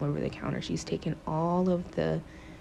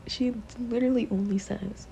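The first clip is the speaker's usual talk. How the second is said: ordinary talk, nothing marked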